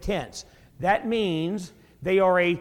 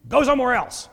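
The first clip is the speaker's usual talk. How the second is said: annoyed homeowner; 4 semitones higher than usual. Retold or imitated. Homeowner woken at midnight shoos away a bread-beggar, mock-angry shouting. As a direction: chase off the pest with hammed-up outrage. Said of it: "I'd be like this guy".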